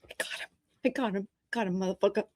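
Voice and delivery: soft gloating I got him; quietly triumphant after the prank; gleeful hushed gloat. Retold or imitated. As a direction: prankster gloating softly from the other room. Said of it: "you're like".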